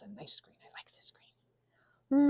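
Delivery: slow whisper to herself. Real, not acted. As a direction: savor the colour, whispered slowly. Whispering while painting.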